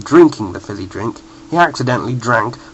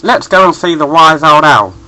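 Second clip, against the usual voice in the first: yellow voice; 3 semitones higher, 6 dB louder than usual. A narrator's reading. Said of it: "his voice was so yellow".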